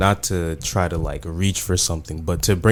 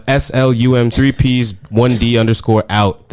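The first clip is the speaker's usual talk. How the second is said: loud callout; 3 semitones higher, 8 dB louder than usual. Real, not acted. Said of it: "say your socials real quick".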